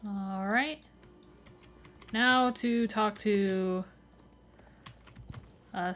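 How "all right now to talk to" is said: ordinary talk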